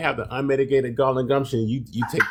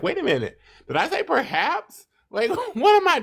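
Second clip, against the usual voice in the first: high, startled self-correction; catching yourself. Retold or imitated. To friends, retelling catching herself mid-word, startled high disbelief. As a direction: squeak up in disbelief at your own word. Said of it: "And I caught myself".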